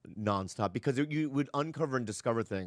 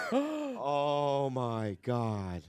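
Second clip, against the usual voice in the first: gasp, then a very drawn-out reaction; awed surprise; 2 semitones higher, much slower than usual. Real, not acted. Host unwraps a surprise gift from guest, awed surprise. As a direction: stretch every word in real amazement.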